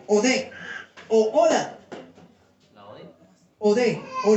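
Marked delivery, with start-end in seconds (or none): 0.1-0.5 "ode" accented on its last vowel; stress on final E. Shown as a demonstration. Preacher teaching the Greek term, "ode" stressed on the final E. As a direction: say "ode" as o-DÉ, landing on the E. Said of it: "con tilde en la E".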